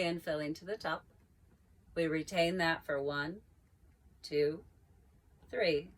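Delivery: calm, unhurried yoga cueing with long pauses; guided breathing. Acted. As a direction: guide the class through each breath gently. Leads guided breathing practice.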